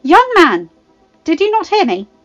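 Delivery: loud, high, indignant scolding; mother telling off an unwelcome caller; sharp rebuke. A narrator's reading. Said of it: "she said sharply".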